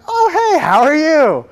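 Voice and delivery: startled high "oh, hey"; caught off guard. Acted. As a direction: cover your guilt with a squeaky greeting.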